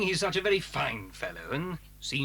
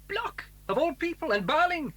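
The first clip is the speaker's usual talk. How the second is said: incredulous; 9 semitones higher, 3 dB louder than usual. Acted. Scientist stunned by the betrayal.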